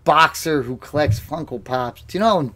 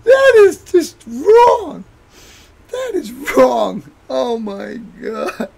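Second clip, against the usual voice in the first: very high wailing speech through tears of laughter; crying with laughter; 12 semitones higher, 3 dB louder, slower than usual. Real, not acted. Mocking friend's New Year's Eve, crying with laughter. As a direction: talk while laughing so hard it hurts.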